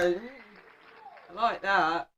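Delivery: ordinary talk, nothing marked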